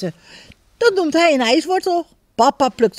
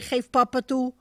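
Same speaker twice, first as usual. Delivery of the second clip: ordinary delivery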